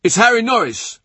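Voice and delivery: high, loud call announcing who's coming; urgent cry of recognition; shout. A narrator's reading. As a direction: shout the news out, pitched right up. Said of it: "Then someone shouts".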